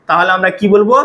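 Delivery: ordinary delivery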